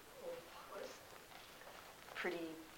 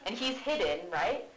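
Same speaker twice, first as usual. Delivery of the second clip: ordinary talk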